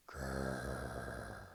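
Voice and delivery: very low, drawn-out soft growl; long low growl. Acted. Narrator voicing the enormous bear, a long low growl.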